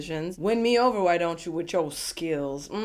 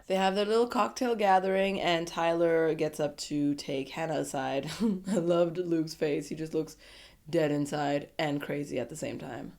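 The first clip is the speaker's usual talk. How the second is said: usual speaking voice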